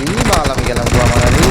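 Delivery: loud frustrated yell; cover me shout. Real, not acted. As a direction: shout as you go down. Getting killed in game.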